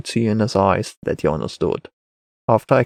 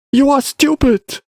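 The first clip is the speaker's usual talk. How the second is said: put-on high, slow boy's insult; insulting child; 4 dB louder than usual. A narrator's reading. Boy insults the Wanderer, high slow child voice. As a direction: throw the insult in a high kid voice.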